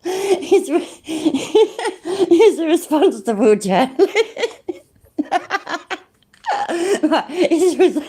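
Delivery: words breaking up into real laughter; helpless laughter. Real, not acted. Streamer repeating the clip's claim, laughing helplessly. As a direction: keep trying to say it through laughter.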